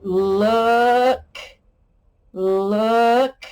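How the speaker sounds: "look" said loud, short vowel; stressed look. Shown as a demonstration. Teacher repeating the word for kids: firm "look". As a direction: hit "look" firmly. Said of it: "ghost eyes say, uh".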